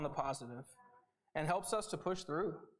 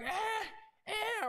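put-on very high whiny baby grunts; fussy baby. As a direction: squeal like a demanding baby. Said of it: "he's like".

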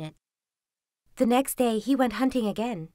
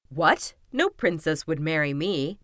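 lower-pitched, doubtful Kuzma; disbelieving young man; 6 semitones lower than usual. A narrator's reading. Kuzma doubting any princess would marry him, low and disbelieving.

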